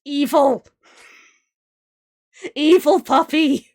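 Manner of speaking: loud, high, slowed Evil puppy; teasing yell at an evil puppy; playful shout. Real, not acted. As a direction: shout it with mock outrage. Cries out over the character.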